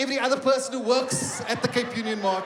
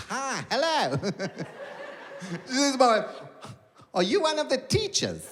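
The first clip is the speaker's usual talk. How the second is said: lightly voiced sweet old granny; a hint of a chirpy old lady asking politely; 4 semitones higher than usual. Granny greets the only mother of color, lightly voiced. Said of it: "old granny came up to her".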